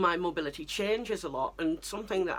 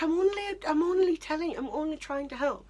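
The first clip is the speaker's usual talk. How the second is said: high defensive mum voice; imitating mum; 10 semitones higher than usual. Mum defending herself after being told off, high and hurt. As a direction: mimic a hurt mother protesting. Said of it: "She's like".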